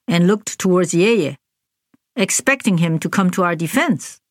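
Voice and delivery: ordinary delivery, nothing marked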